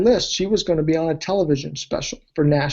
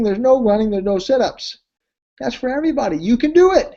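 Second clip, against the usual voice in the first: emphatic pitch; 6 semitones higher, faster than usual. Retold or imitated. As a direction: sell it hard, rising to the end.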